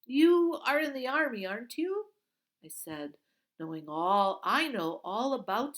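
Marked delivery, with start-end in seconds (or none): 0.1-2.1 high, loud, bright-eyed question; eager girl. Acted. Girl distracts the guard, eagerly high and loud.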